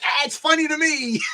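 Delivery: high-pitched comic comeback; playful squeal just before laughing; mock gleeful retort. Acting out own unbothered comeback.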